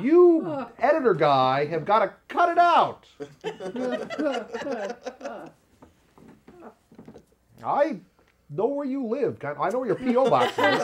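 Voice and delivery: hammed-up warning to the editor; mock threat. Vlogger jokingly threatens his editor, mock menacing.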